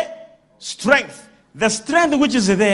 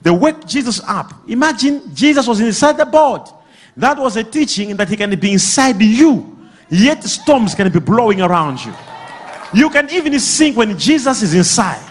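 ordinary talk, nothing marked

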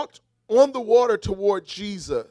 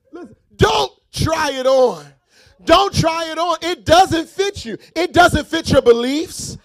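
loud, high, fervent preaching over music; preaching at full force; 8 semitones higher, 8 dB louder than usual. Acted. Preacher pressing the church, full-force sung preaching. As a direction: drive it home like a preacher at the peak.

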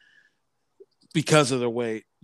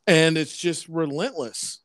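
ordinary talk

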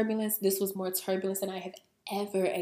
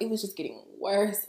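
ordinary talk, nothing marked

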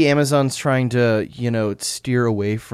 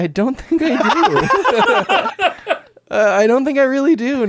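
laughing it off; 13 semitones higher than usual. Real, not acted. Laughing at being asked.

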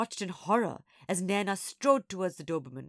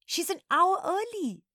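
alarmed; 12 semitones higher, 6 dB louder than usual. A narrator's reading. Alarmed girl notices teacher.

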